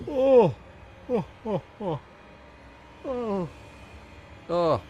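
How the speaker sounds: big high-pitched yawn; real yawning. Real, not acted. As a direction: yawn out loud in high bursts. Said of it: "I don't know why I'm yawning so much".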